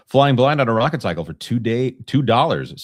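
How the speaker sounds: announcer read. Pretend. Reading a donor message aloud.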